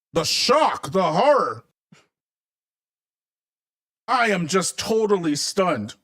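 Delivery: drawn-out sarcastic astonishment; mock shock. Host mocking news of discriminatory laws, mock shocked. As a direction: feign horror, every word deliberate.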